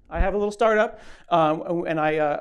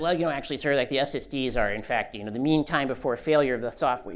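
a hint of a know-it-all nerd correcting the speaker; mild nerdy heckler; dork voice; 4 semitones lower than usual. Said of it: "but I know how to do a dork".

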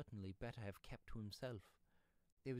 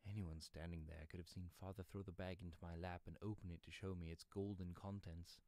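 ordinary delivery

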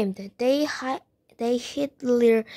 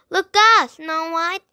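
high, loud cry of alarm; shouted warning; 5 semitones higher, 5 dB louder than usual. A narrator's reading. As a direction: shout to warn her. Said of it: "she shouted".

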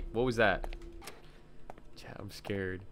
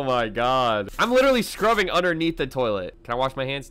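ordinary delivery

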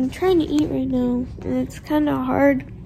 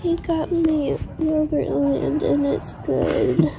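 slow, drowsy post-op voice; sleepy loopy talk, very slow; groggy from anesthesia; slower than usual. Real, not acted. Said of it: "I'm still loopy from anesthesia".

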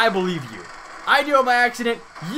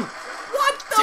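shocked cry; 4 dB quieter than usual. Real, not acted. Sudden shock.

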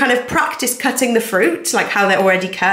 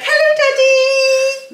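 put-on high squeaky baby voice; 4 dB louder, much slower than usual. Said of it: "We were like".

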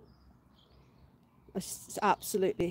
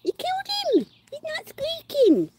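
high pet talk; 4 dB louder than usual. Real, not acted. Owner teasing the dog about the silent toy, squeaky high voice.

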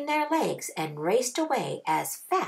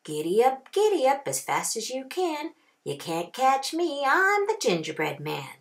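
higher, sing-song teasing chant; taunting gingerbread cowboy; 6 semitones higher than usual. A narrator's reading. Gingerbread cowboy taunting the rancher, sing-song. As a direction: tease them from a safe distance. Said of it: "just laughed and said".

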